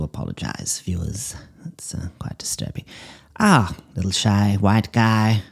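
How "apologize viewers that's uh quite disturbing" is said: whispered apology to the viewers; hushed aside. Real, not acted. Psychic whispers an apology to viewers, hushed. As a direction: drop to a whisper, embarrassed.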